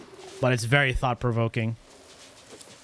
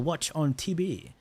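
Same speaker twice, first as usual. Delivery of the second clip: usual speaking voice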